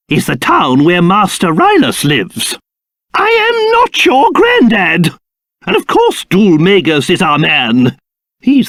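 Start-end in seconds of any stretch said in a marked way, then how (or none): 3.1-5.1 high, affronted retort; pompous, high-pitched indignation; indignant. Acted. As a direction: bristle with wounded dignity. Offended at being called granddad.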